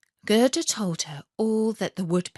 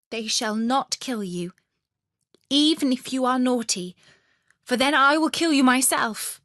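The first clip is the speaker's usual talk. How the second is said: playful threat; 5 semitones higher, 4 dB louder than usual. Acted. Robber girl's threat.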